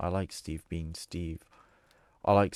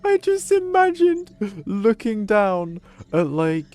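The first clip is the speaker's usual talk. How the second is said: laughing; 6 semitones higher, 8 dB louder than usual. Real, not acted. Cracks up imagining branded bones.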